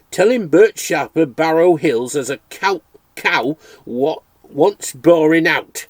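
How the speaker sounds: a cheerful Dales farmer calling at the door; Yorkshire farmer. Acted. Farmer Bert Sharper speaks.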